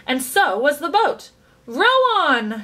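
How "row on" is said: louder, higher command to the rowers; shouting. A narrator's reading. Chocolate maker urging the rowers on, shouting. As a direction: call out over the boat. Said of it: "shouted Mister Wonka".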